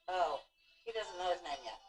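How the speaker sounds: usual speaking voice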